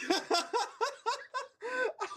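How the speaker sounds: high-pitched laugh. Real, not acted. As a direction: burst out in a squeaky laugh. Laugh between the two laments.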